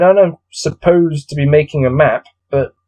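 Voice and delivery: usual speaking voice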